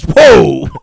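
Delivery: loud retold whoa of shock; shocked reaction. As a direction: burst out in mock astonishment, loudly. Retold startled reaction to insult.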